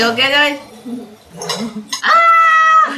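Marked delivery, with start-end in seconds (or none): 2.0-2.9 sudden high shriek as if mouth burns; fake spicy shriek. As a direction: yelp as if the chili burns, then laugh. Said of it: "Just scare you".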